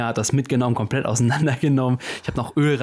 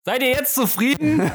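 slow, high mock-grand call; theatrical arena voice; 13 semitones higher than usual. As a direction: proclaim it like a gladiator. Theatrical outro after sign-off.

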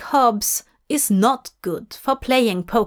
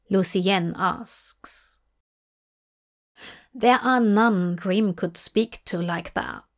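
usual speaking voice

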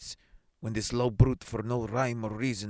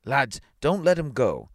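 raised, slow urgent call; 5 semitones higher, 6 dB louder than usual. A narrator's reading. A man calling lads to stop the dog, calling out. Character calls out to others.

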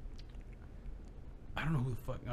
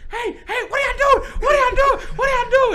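put-on much higher, louder girlish yell; little sister yelling; 7 dB louder than usual. Cynthia yelling at the boys in the ditch, high loud. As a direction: shriek it like a chubby little girl. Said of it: "Cynthia's picking up her shorts".

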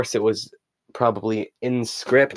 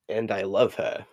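put-on softer, lightly British-accented delivery; 6 dB quieter than usual. Podcaster naming Paul's song, lightly put-on British accent. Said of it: "do I keep talking in British?".

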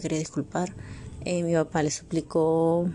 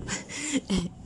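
high nervous laugh; 9 semitones higher, 5 dB quieter than usual. Real, not acted. Nervous laugh after hoping.

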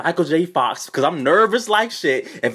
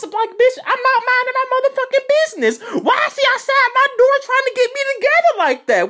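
very high-pitched, rushed mock protest; hurt indignation. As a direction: wail the complaint like a wronged victim. Acts out own hurt, indignant reaction.

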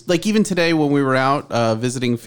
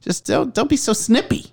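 much higher pleading; retold plea, pitched up in exasperation; exasperated plea; 10 semitones higher than usual. Husband pleading with his snippy wife, exasperated.

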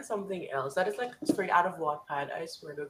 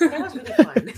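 laughing; 9 semitones higher, 12 dB louder than usual. Real, not acted. Laughing after asking question.

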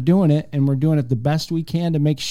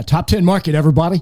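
radio voice; 4 semitones higher, 4 dB louder, faster than usual. Acted. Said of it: "Throw the radio voice on".

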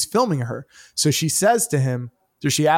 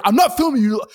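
defensive denial; 9 semitones higher, 5 dB louder than usual. Retold or imitated. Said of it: "And he's like".

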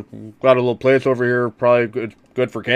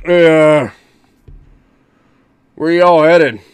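louder, higher drawling worker; gruff foreman voice; 4 semitones higher, 7 dB louder, much slower than usual. Acted. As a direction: call out like a rough foreman.

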